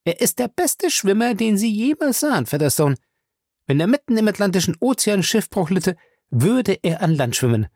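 high, emphatic pride in her husband; proudly insistent, pitched high; proud boast. A narrator's reading. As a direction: boast with full conviction. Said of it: "sagte sie stolz".

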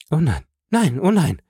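high, dismayed wife's voice; acted dismay; horrified protest. A narrator's reading. Accused wife protesting in horror.

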